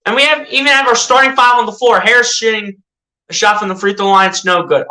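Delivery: ordinary talk, nothing marked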